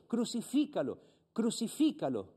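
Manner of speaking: a light hint of the crowd crying out; mock crowd shout. Pastor reads the priests shouting for crucifixion, mock cry. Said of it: "dieron voces diciendo".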